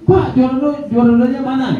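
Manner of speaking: high, mock yelling heckler; heckler shout. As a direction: call out from the crowd to interrupt. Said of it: "Dia teriak".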